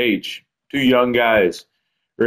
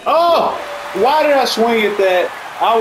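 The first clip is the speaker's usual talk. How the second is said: frustrated; 11 semitones higher than usual. Real, not acted. Annoyed at own swing.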